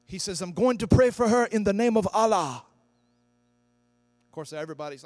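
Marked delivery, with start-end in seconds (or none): 0.4-2.6 loud, higher booming preacher announcement; Reinhard Bonnke impression. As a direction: proclaim it boldly to the crowd. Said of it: "That's how he talks".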